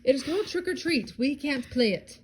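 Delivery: high, insistent correction; called out. A narrator's reading. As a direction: call it out in an exasperated high voice. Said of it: "Morris called".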